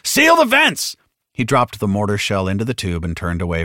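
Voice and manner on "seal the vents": high, loud emergency command; urgent full-voiced yell; bellowed order. A narrator's reading. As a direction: bellow it to your crew, pitched way up. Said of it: "Hicks bellowed".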